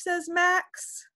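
plain reading voice

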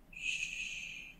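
soft, voiceless shush; hushing. A narrator's reading. Reader hushes after the silent ladybird, soft shush. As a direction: hush the listener very quietly.

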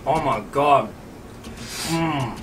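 ordinary talk, nothing marked

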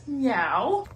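playful mock meow as a cat; a cute little pretend meow. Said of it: "I am a cat".

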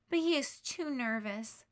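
usual speaking voice

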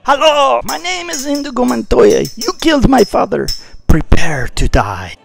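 bad Spanish accent. Avenger naming himself to the killer, hammed Spanish accent. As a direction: ham up a Spanish swordsman accent. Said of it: "You sound like a vampire".

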